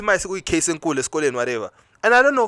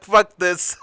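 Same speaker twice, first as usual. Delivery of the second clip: higher, fed up and tearful; fed-up exclamation; 5 semitones higher, 3 dB louder than usual. Retold or imitated. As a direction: throw it out as you walk away. Storming out of the office.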